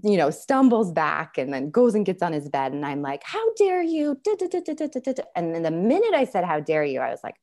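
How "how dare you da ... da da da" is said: high-pitched mock telling-off, rattling into da-das; mock indignant scolding. Mom lecturing son on his bed, mock indignation. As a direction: imitate a parent's shrill-pitched lecture.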